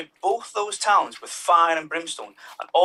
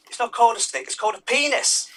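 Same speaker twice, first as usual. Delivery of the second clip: exasperated God; 7 semitones higher than usual. Acted. God scolds Adam.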